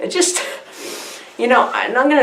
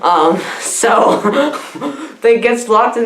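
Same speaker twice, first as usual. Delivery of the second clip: usual speaking voice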